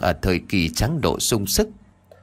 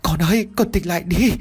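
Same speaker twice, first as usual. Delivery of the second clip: wailing mother; 9 semitones higher than usual. Acted. Mother Lan wailing over her son's body, high and tearful. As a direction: cry out over her dying son. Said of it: "ôm anh Út mà gào khóc".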